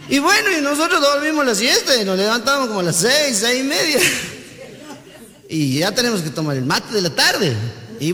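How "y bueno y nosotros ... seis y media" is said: a lightly voiced lazy santiagueño, a bit higher; lazy local interviewee. Santiagueño telling the TV reporter his day, a touch higher and lazy. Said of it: "empieza a hablar este y dice".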